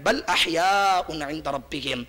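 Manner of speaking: preacher re-chants the verse fragment slowly; Quranic recitation. Acted. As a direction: intone the phrase like a reciter. Repeating verse phrase.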